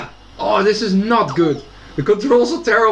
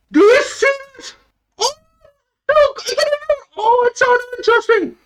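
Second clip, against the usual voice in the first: high, shrieking with delight; excited screaming; 13 semitones higher, slower than usual. Real, not acted. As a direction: scream it out, overjoyed. Thrilled by projector image.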